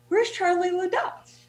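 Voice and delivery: high-pitched quoted question; imitating curious public. Host imitating people who ask after her guest, high and curious.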